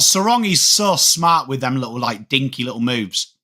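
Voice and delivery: ordinary talk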